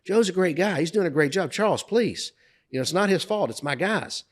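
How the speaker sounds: high-pitched, pleading intervention; retold protest. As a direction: imitate a client hurriedly calming an angry boss. Client defends narrator to berating boss.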